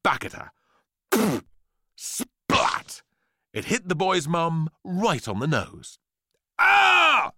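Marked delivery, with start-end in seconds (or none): 2.0-3.0 voiceless spluttered impact noise; spat splat. A narrator's reading. Fruit hits mum's face, spluttered splat. 6.6-7.3 put-on high, loud kid's scream. A narrator's reading. Boy tastes grapefruit, loud high scream. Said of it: "screamed Frankie".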